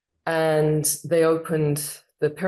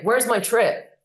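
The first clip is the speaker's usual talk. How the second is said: impatient demand; 8 semitones higher, 4 dB louder than usual. Retold or imitated. Asking again for her trip.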